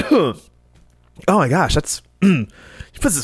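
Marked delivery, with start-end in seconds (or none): none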